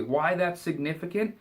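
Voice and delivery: usual speaking voice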